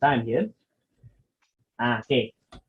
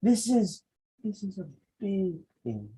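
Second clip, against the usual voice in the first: "big thing" said soft and small, the opposite of big; whisper-small "big thing"; mismatched tiny voice; 5 semitones higher, 6 dB quieter than usual. Shown as a demonstration. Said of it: "If you do the opposite".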